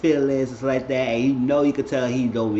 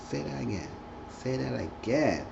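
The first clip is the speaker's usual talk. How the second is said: flirty coaxing; 9 dB quieter than usual. Retold or imitated. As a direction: coax a lover quietly, playacted. Acted flirting between lovers.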